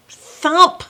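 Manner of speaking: ordinary delivery, nothing marked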